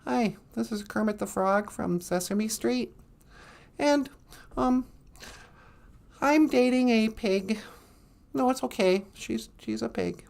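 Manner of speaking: a hint of the frog puppet, sheepish; Kermit the Frog. Doing a Kermit impression for the chat, lightly voiced and soft. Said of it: "I can do Kermit".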